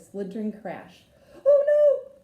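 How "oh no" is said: very high, loud shocked cry; piercing high "oh no"; loud exclamation. A narrator's reading. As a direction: shriek in alarm. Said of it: "Goldie exclaimed".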